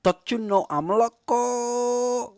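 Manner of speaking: slow, high drawled movie-quote voice; imitating the clip. [name] echoing the transition clip in a slow high voice. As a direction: stretch it out like the movie line.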